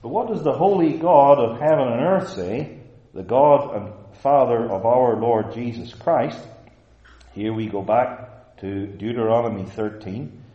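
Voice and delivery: loud, emphatic scripture declamation; forceful preaching. Real, not acted. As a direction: proclaim God's word with weight. Preaches and declaims God's word.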